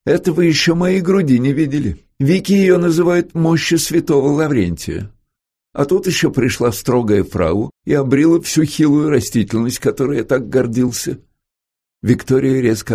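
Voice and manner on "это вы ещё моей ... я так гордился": higher, lightly comic complaint; comic self-mockery. A narrator's reading. Lawrence jokes to visitor about his bony chest, comically. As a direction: play up the mock grievance. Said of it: "Лоуренс сделал комичную гримасу".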